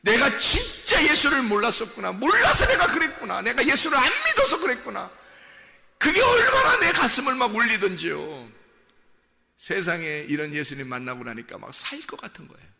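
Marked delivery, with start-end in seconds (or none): 0.0-5.1 high, raised cry of regret, re-enacted; retold weeping lament. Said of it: "펑펑 울면서". 6.0-8.5 high, loud start falling away; impassioned cry. Real, not acted. Preacher's climax of testimony.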